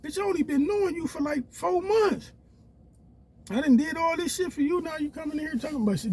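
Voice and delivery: high-pitched exasperation. Retold or imitated. Man telling girlfriend off, high and exasperated. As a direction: voice jumps high in disbelief. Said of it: "I'm like".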